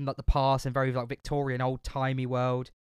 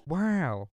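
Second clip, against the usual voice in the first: Owen Wilson impression; 4 semitones higher than usual. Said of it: "we haven't said Owen Wilson in so long".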